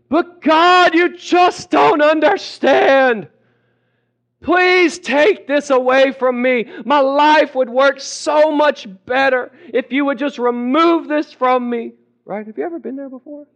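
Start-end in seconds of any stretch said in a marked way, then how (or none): 0.1-11.9 high, loud, drawn-out protest; over-dramatic pleading. Acted. Believer protesting to God, over-dramatic begging. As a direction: wail it out, hammed-up and slow. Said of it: "Maybe not this dramatic".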